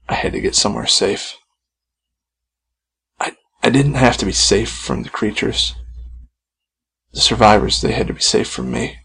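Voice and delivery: ordinary delivery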